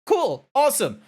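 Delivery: sarcastic cool. Real, not acted. Sarcastic reply to chat.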